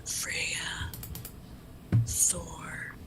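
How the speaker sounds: hushed, slow whispered prayer; soft, secretive invocation with long pauses; under her breath. Acted. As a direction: whisper slowly to the gods, keeping it from being overheard. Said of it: "kind of under her breath".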